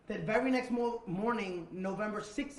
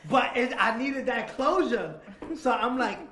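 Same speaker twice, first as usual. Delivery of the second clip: 1 worked up; 6 semitones higher, 11 dB louder than usual. Real, not acted. Excited retelling, laughing.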